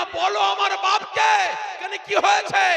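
high, loud shouted demand; furious shout. Acted. Son shouting at his parents, furious shout. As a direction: yell it in rage.

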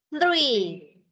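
THREE with air blown through the TH; THREE, airy TH and falling tune. Shown as a demonstration. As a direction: push a puff through tongue and teeth on THREE. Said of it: "¿Sientes aire en tu mano?".

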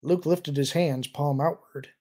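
ordinary delivery

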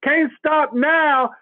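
high, slow and emphatic, each word driven home; rousing preacher call. Real, not acted. Host urging the group to keep pedaling, high slow rousing call. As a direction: preach it like a revival chant to keep them going. Motivational pep talk to group.

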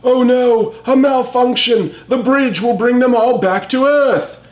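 high, hammed-up robot panic; mock alarm. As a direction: gasp out the malfunction like a cartoon scientist. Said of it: "he's thinking to himself".

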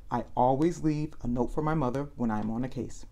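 ordinary delivery

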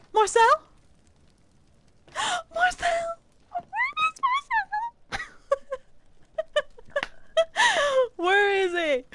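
high squealing cries for a friend; shrieking in half-laughing panic; high, frantic calling out; panicked calling. Real, not acted. Searching for missing friend.

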